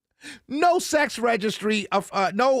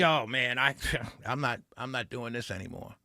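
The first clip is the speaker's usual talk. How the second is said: fed-up rural voter; 11 semitones lower, 9 dB quieter than usual. Retold or imitated. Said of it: "And he's saying".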